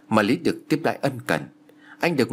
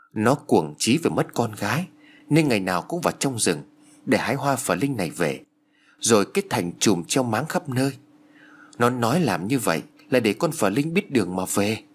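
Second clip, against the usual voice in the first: ordinary delivery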